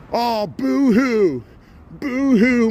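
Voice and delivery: hammed-up mock crying, high and slow; mock boo-hoo. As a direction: tease him with a drawn-out pretend boo-hoo. Mocking a politician's complaints.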